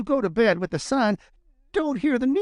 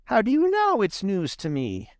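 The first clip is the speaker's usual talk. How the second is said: higher-pitched rabbit, only mildly grumpy; not quite so cross; 7 semitones higher than usual. A narrator's reading. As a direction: ask with the crossness easing off. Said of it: "his voice wasn't quite so cross".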